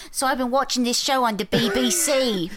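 high put-on British accent. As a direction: ham up a British fan's voice. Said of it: "wrong accent".

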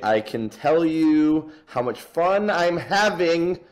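slow, high, dripping sarcasm; drawn-out sarcastic complaint. Real, not acted. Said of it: "Can you tell how sarcastic I am?".

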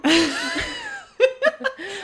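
loud, high-pitched laughing fit; burst of laughter. Real, not acted. Joke about a passing train.

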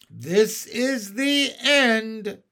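slow, drawn-out cry of doom; despairing cry. Acted. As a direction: moan it as the end. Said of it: "cried the old green grasshopper".

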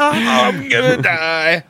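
slow, high mock wail of doom; mock panic. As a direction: ham up a passenger sure they're dying. Mocking the stuck passengers.

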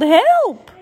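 high, loud cry for help; calling for help. Acted. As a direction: shout up from the sky. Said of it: "he woke up and called".

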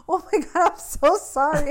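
high, loud, drawn-out imitation of apologizing; imitated over-the-top sorry; mock frantic apology. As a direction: gush an exaggerated apology, half amused. Retelling partner's panicked apology to crying.

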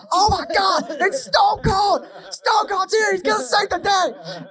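high, screaming play-by-play yell; excited wrestling commentator. Retold or imitated. Fan mimics the TV commentator yelling as Stone Cold arrives. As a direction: scream it like a commentator losing his mind. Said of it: "the commentator going".

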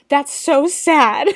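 high, loud, drawn-out pity; big, slow cry of dismay; emotional outburst. Real, not acted. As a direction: wail it with exaggerated sympathy. Exclaiming over the lonely child.

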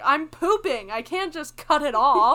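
calling out from the bathroom; yelling back. Retold or imitated. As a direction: shout it back through the door.

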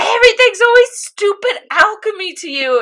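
fed-up scold; exasperated Winry. Retold or imitated. Winry scolding Ed about alchemy, exasperated and high. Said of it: "she goes".

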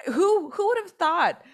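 high, loud, theatrical wonder; hammed-up storyteller; mock theatrical. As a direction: play it like a fable.

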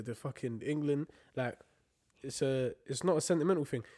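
ordinary talk, nothing marked

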